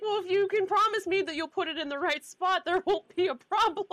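cheeky laughing reply. Retold or imitated. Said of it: "I was like".

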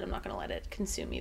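a lightly voiced grave vow; imitating T'Challa. Fan quoting T'Challa's vow to a cohost, low mock gravity.

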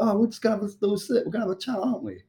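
high, light soothing coax; imitating a soothing, sing-it-up reassurance; gentle coaxing. Helper coaxing a distressed woman to sit for a chat, high gentle coaxing.

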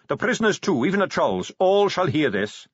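high, commanding snap; snapped royal order. A narrator's reading. King orders the captain to fetch everyone, snapping. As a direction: order it with a lifted, imperious voice. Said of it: "the king snapped".

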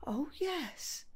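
breathy hushed whisper. Acted. Confused gran whispering to her daughter. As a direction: murmur it to yourself, barely voiced.

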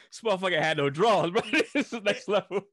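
laughing, loud and high. Real, not acted. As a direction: crack up at the memory. Laughing while telling.

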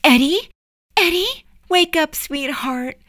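high, lilting wake-up call; tender high-pitched motherly voice; sweet, soothing mother. Acted. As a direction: coax a child gently awake in a high voice. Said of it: "Her voice was sweet and soothing".

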